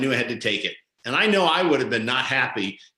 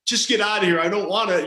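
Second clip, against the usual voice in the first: higher-pitched imitation of pleading; begging; 5 semitones higher than usual. Lead singer begging the driver not to go back, pleading. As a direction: mimic a passenger begging to leave. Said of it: "begging me not to do it".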